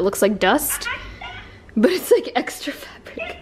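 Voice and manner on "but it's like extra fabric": whispered through giggles; breathy laughing whisper. Real, not acted. Laughing whisper about couch fluff.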